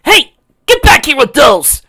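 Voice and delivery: very high, loud shout. Acted. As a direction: yell after her hard. Sonic shouting after thief.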